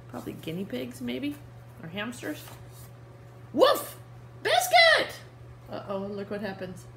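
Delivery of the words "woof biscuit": much higher, louder startled shout of Biscuit; startled call. Acted. Reading to kids, the girl yells as Biscuit jumps in. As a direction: yelp his name in alarm.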